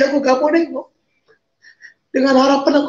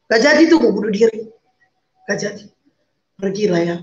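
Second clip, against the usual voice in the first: ordinary talk, nothing marked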